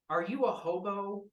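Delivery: incredulous villain; villain's blunt question. Acted. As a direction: ask it flatly, amused. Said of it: "Plague goes".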